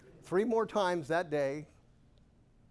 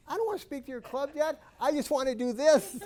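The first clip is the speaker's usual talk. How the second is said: higher and louder, laughing defiance; excited retort; 11 semitones higher, 6 dB louder than usual. Retold or imitated. Speaker replaying his retort to Jack, high and loud with laughter. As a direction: throw it back at him, half laughing.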